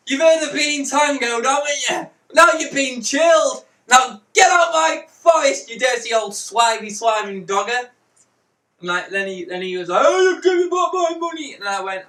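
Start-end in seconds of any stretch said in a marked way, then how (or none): 0.1-7.9 loud, high taunting yell; triumphant shouting. Retold or imitated. Retold chasing off the stranger. 10.0-11.5 high, loud mock wail; protesting victim. Retold victim demanding money back.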